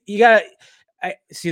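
ordinary talk

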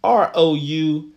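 letters spelled out slowly; slow spelling. Shown as a demonstration. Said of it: "That's O F F I C I A L L".

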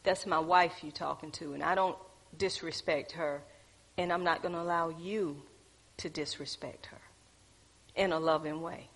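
quiet, calm and steady; low-voiced gentle stand for a spouse; humble, loving firmness. Shown as a demonstration. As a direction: defend your wife softly but firmly, in a loving way. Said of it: "humble yourself and say".

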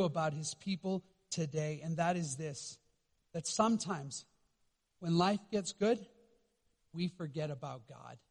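dropping to a near hush. Real, not acted. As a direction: draw them in, very softly. Hushed turn in the sermon.

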